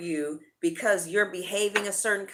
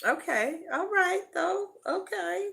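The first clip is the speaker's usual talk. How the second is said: surprised okay; 11 semitones higher than usual. Real, not acted. Tarot reader surprised by the cards.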